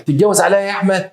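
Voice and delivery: high, indignant mock question; betrayed wife's reproach. First wife reproaches husband for second marriage, high indignant reproach.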